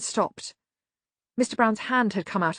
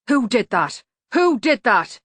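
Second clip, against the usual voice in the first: loud, rising anger on the repeat; sharp demand; 5 semitones higher, 6 dB louder than usual. A narrator's reading. Medium Dave confronting the gang, loud angry demand. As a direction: demand an answer, pushing higher. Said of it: "demanded Medium Dave".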